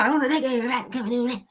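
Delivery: high, very fast slurred-together imitation; Eddie Long. Host repeats the preacher's baffling greeting, high rushed mock voice. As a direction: squeeze the greeting into a quick odd voice. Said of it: "Like, what is that?".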